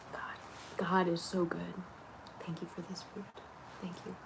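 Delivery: soft breathy grace over food; whispered prayer. Real, not acted. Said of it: "I pray when I like to make my food".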